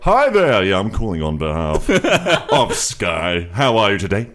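put-on loud, over-jolly old salesman on the phone; dad-like phone character. Call-centre worker puts on an old man who loves his job, hammed-up jolly dad voice. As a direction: boom out a cheery call-centre greeting like a dad. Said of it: "weird character who just sounds like my dad".

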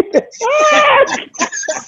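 high squealing laugh; shrieking laugh. Real, not acted. Laughing at the clip.

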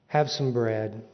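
low, soft kindly voice; gentle forgiveness. Retold or imitated. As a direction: offer grace gently.

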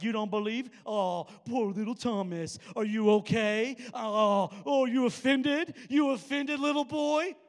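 loud mock pity for a little boy. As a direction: taunt him like a sulking kid. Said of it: "Jesus could have went in and been like".

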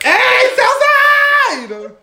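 very high, loud, long-drawn whoop; party holler. Retold or imitated. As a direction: holler it to the camera like a party girl, stretched out. Shouting on Snapchat, retold.

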